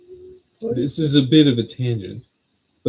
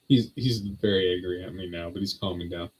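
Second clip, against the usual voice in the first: ordinary talk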